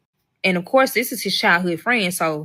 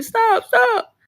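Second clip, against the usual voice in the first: mock scream. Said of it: "he's screaming".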